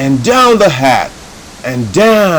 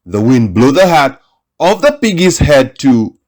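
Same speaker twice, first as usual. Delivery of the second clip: ordinary delivery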